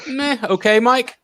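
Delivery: high-pitched noncommittal "meh" persona; mock apathetic. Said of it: "like, Apathetic Mike".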